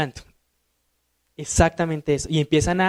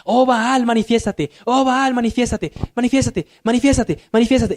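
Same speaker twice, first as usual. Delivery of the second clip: higher, pleading mock chant; imitating Baal prophets; 5 semitones higher than usual. Prophets of Baal crying to their god, higher and pleading. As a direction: beg the idol over and over, higher. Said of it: "me imagino que dirían".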